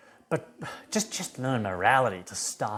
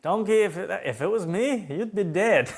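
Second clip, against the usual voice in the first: higher, laughing Shrek comeback; Shrek quip; 8 semitones higher, 3 dB louder than usual. Retold or imitated. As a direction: toss off the joke, laughing through it. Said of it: "he says".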